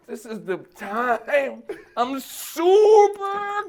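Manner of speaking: slow, climbing to a high, loud finish; drawn-out mock-heroic shout; hammed-up eager reply. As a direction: stretch it out and burst upward. Retold eager answer, acted up.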